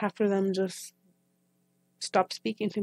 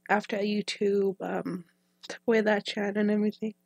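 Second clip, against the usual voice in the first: ordinary talk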